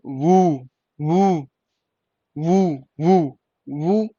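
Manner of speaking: वू with ऊ held long; long vowel. Shown as a demonstration. Teacher showing how double o is read, long ऊ. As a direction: slowly repeat a drawn-out वू. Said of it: "बड़ी ऊ की sound आ रही है".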